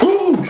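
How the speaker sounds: very high-pitched hoot; imitated high yelp of surprise; sudden squealed "ooh"; startled "ooh". Imitating reaction to being pulled.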